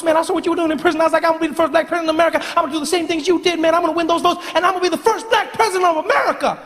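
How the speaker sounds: high, fast, breathless fanboy gush; squeaky rushed superfan rambling at his hero; young Obama voice. Acted. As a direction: pitch way up and rattle it off like an overexcited kid. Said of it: "Not with that voice".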